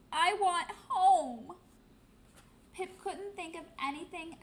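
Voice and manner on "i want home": loud, high, slow wail. Acted. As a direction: cry out for home, sobbing. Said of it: "she wailed".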